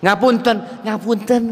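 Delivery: lightly imitated, gentle polite Javanese apology; bow your voice a little, humbly; polite Solo apology. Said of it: "mohon maafnya orang Jawa apa?". (orang Solo minta maaf dengan halus)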